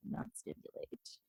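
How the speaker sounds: "not stimulate" breathed out in a whisper; whispered reveal. Acted. Host whispering the prompt word to her guest.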